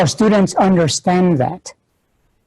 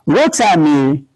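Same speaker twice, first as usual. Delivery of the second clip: ordinary delivery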